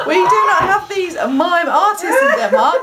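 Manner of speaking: laughing through the lines; a lightly played Danish art dealer breaking up.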